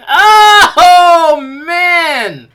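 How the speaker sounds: loud, high cry of disgust; disgusted shout. Real, not acted. Vlogger recoils from an ugly dog picture, shouting in disgust. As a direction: yell out in revulsion.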